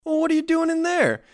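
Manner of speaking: put-on a little boy telling off a prankster; a boy scolding the voice behind the door; chiding boy. A narrator's reading. Boy questions the voice.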